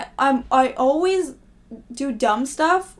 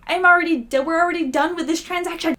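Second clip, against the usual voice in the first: exasperated; 5 semitones higher than usual. Retold or imitated. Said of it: "and I'm like".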